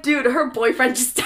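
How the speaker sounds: exasperated retort. Acted. Podcaster arguing back at Harry over the dead boyfriend, exasperated. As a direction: protest loudly, breaking into a laugh.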